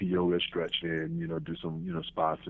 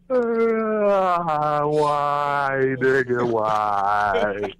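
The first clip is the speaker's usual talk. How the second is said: anguished cry; 7 semitones higher, 9 dB louder, much slower than usual. Acted. Acting out the Shawshank sky moment.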